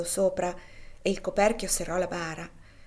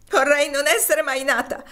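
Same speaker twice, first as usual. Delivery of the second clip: grieving cry; 7 semitones higher, 9 dB louder than usual. Acted. Topsy in grief at deathbed.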